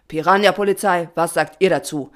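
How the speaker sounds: loud, official question; addressing the crowd. A narrator's reading. Patron asks the swarm, loud commanding address. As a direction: throw the question out to everyone. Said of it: "fragte der Patron in Schwarm".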